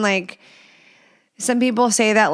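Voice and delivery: ordinary delivery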